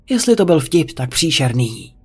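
usual speaking voice

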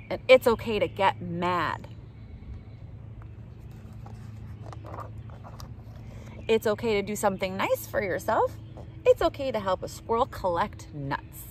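lively storybook read-aloud voice; reading to children. Acted. Reading a picture book aloud to kids, bright and lively. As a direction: read it out brightly for little listeners.

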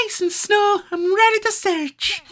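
very high Scottish pup catchphrase. Pretend. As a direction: chirp it like a Scottish cartoon pup. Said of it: "it's just the voice".

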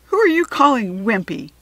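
loud, offended challenge; angry demand. A narrator's reading. Carlos snapping at the lunch lady, angry and loud. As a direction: confront her, insulted. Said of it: "Carlos demanded in an angry voice".

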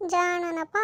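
ordinary talk, nothing marked

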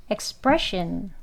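expression slow, stress on pre; stress before -sion. Shown as a demonstration. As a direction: draw out expression, hitting pre. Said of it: "이 신 하는 소리 앞에에 강하게".

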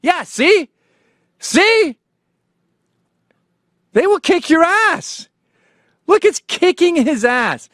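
very high, gleeful yelling; excited shouting. Real, not acted. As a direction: shout along with the fight, voice way up. Excitedly reacting to fight video.

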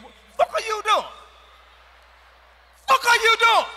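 high, loud startled yell; outraged shout. Retold or imitated. Man yelling at his woman pushing his head down. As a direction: shriek it in disbelief.

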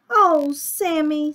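high, loud drawn-out groan; dismayed children. Acted. Children scold Sammy for eating storybook, groaning. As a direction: scold him in chorus style.

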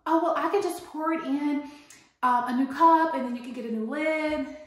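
smooth it over like a nervous barista; high, eager mock customer-service voice; pleading barista. Barista voiced in retold argument.

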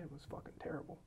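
hushed grumble to yourself; whispered complaint. Retold or imitated. Teller retelling his private gripe about the gym, whispered. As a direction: whisper it under your breath.

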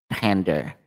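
"Pander" said alone with the "a" of "pan"; accented pronunciation. Real, not acted. Tarot reader checking her own word, accented pronunciation. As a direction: repeat "Pander" as "PAN-der", dropping in pitch. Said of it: "Sorry for the accent".

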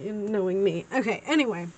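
ordinary talk

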